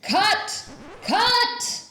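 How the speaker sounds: high, loud call to stop the scene; shouted cut. Acted. Director professor halting the failed scene, loud call. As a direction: yell cut like a stage director.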